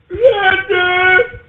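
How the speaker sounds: high, crying, squeaky yes sir; engineer's reply. Acted. Engineer answering the host's order, high squeaky yes sir. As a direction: play the cowed engineer answering.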